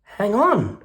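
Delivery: high, loud sudden quack; quacking duck. Acted. Duck stopping the rat, high quacky cry. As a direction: blurt it like a startled duck. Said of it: "quacked the duck".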